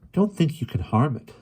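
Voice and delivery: high, mildly haughty warning. A narrator's reading. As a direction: warn off the intruder from a height. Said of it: "the Lorelei warned".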